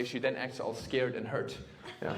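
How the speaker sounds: ordinary talk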